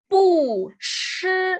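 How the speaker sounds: "bu" falling from high, "shi" held high and level; original fourth tone on bu. Shown as a demonstration. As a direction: drop "bu" hard, then sustain "shi" high. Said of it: "mantiene su tono original".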